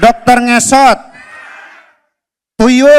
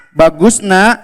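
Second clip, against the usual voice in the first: lower, calm fatherly reply; 5 semitones lower, slower than usual. Acted. Father answering his son Abdi, low and calm. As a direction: answer as a gentle dad.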